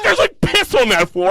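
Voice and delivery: mock girlfriend. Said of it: "She was like".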